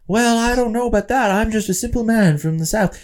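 mock Gary Cooper aw-shucks voice; old-Hollywood folksy hero. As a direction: play a humble 1930s movie-star hero. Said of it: "Gary Cooper, Jimmy Stewart performance where he's like".

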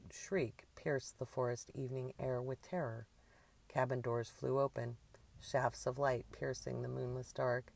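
plain reading voice

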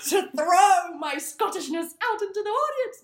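loud, high theatrical flourish; mock-dramatic, big and high-pitched; hammed-up showing off. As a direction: fling it out grandly to a crowd. Hamming up own eagerness to perform.

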